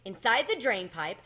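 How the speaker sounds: usual speaking voice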